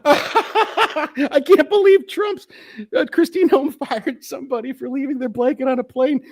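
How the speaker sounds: squealing mock giggle, very high; mock laughing TikToker. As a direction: laugh your way through the news. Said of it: "you guys laugh".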